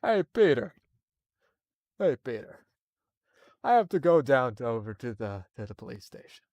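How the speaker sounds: lightly voiced neighbour cop; a hint of a gruff cop buddy; Joe Swanson impression. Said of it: "I can do a decent Joe Swanson".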